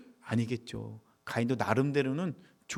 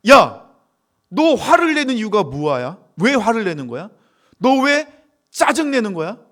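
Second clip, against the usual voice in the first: acted loud, high telling-off; God confronting Cain in everyday speech; scolding; 7 semitones higher, 11 dB louder, slower than usual. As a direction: call out sharply, then demand a reason.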